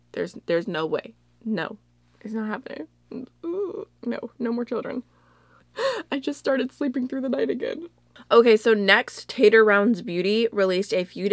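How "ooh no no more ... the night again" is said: mock horror. Joking horror at another pregnancy.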